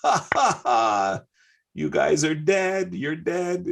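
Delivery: gloating high laugh running into the words; imitated chortle. Bobby Fischer gloating at the grandmasters, high chortling laugh. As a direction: cackle it out like a triumphant champion. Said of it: "he starts chor-, chortling".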